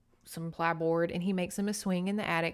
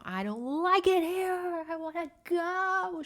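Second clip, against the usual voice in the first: higher, slow whiny tantrum yell; retold child scream; 10 semitones higher than usual. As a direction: wail it like a furious four-year-old. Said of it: "she's screaming at the grandma".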